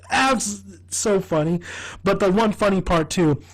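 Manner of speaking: ordinary talk, nothing marked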